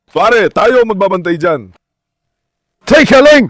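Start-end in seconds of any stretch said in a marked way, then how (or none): 2.8-3.5 sudden loud, high call to wait; urgent shout. Real, not acted. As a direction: shout to halt the dog right away. Biglang pinipigilan ang aso. (biglang sigaw na pagpigil)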